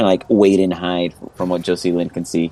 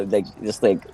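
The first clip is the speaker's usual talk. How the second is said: usual speaking voice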